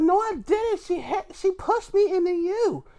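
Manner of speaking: high-pitched mock accusation; put-on falsetto complaint; mock squabbling wrestler. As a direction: squeal it like an indignant wrestler, played for laughs. Acted ring argument between wrestlers.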